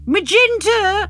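high, long drawn-out call; distant shout. Acted. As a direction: call out a name from far away. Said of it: "I hear Bernard shout in the distance".

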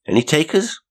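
high, rising call; offering a bet. A narrator's reading. Young man offers the passengers a bet, high and inviting. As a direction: throw out the offer, pitched well up.

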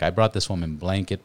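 normal talking voice

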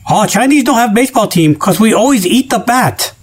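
hammed-up Chinese accent punchline; mock Chinese accent.

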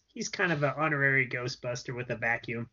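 ordinary talk, nothing marked

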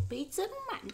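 giggling. Real, not acted. Joking toast, giggling.

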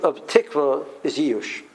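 ordinary talk, nothing marked

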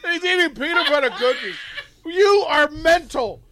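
laughing hard, high and loud; cracking up in disbelief. Real, not acted. Laughing at friend eating cookies.

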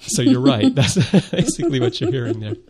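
high-pitched, laughing through the words. Real, not acted. Host agreeing with co-host about the accent, laughing. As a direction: agree while cracking up. Laughs after telling the Florida anecdote.

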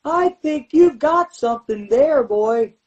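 high, eerie drawn-out voice; spooky high mock manager voice; imitating Paul Bearer. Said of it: "Paul Bear looked at me and goes".

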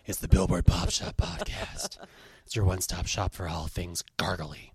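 low, dark, sultry host voice; sultry narrator. Shown as a demonstration. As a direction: purr the intro low and sultry. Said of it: "I like my sort of dark and sultry voice".

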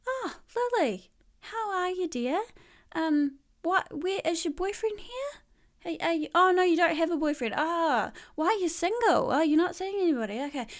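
put-on high, prying old-lady voice; a lightly hammed nana quizzing her granddaughter; nosy Nana. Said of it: "And Nana goes".

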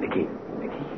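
soft unvoiced whisper to wake her. Real, not acted. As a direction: wake her gently without voice. Waking wife gently on plane.

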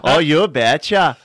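slow, drawn-out Wisconsin accent, verging on Irish. Shown as a demonstration. Comedian proving his accent to hosts, wobbly Wisconsin accent. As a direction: stretch it in a bad Wisconsin accent. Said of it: "all my Wisconsin accents".

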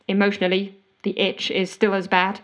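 ordinary delivery

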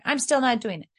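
put-on high-pitched stubborn kid; defiant child. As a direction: dig in like a refusing child. Acts out child still refusing.

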